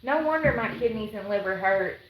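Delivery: ordinary delivery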